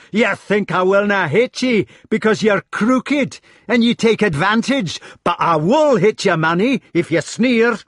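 loud, high Scots tirade; shouted threat. A narrator's reading. Clan head threatens his crooked brother, shouting. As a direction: threaten at a shout in a Scottish accent. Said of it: "he shouted".

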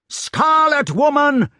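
high, loud, drawn-out denunciation; outraged exclamation. A narrator's reading. Knight goes for his wife, outraged exclamation. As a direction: hurl it at her, stretching the words. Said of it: "exclaimed the knight".

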